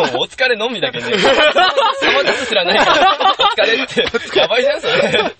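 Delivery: high, slow words broken by laughing; helpless laughter. Real, not acted. Guest admitting the silly slip to co-hosts, laughing helplessly. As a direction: laugh all through the admission.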